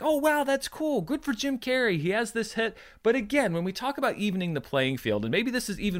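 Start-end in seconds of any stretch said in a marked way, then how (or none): 0.0-2.7 light, higher-pitched mock approval; mildly impressed. Imagined viewer shrugging off Jim Carrey's numbers, mildly impressed. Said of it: "you're like".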